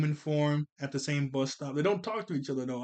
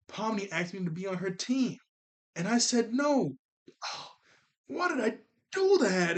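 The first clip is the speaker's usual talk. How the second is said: put-on high, upset voice of a regretful girl; voicing Ragatha; 6 semitones higher than usual. Vlogger quoting Ragatha's regret about refusing Pomni, in a high, upset voice. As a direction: put on Ragatha's higher voice, pained by what she did. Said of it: "But when Ragatha said".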